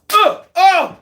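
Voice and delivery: high, loud uh oh; shocked. Retold or imitated. Reliving the twelfth round knockdown, high shocked shout. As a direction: cry out at the big punch.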